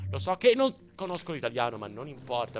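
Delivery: usual speaking voice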